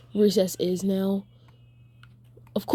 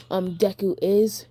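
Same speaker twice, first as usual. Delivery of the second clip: usual speaking voice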